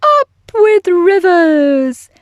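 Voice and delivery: slow, loud, high joyful hoot; long hooted cheer. A narrator's reading. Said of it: "And they hooted".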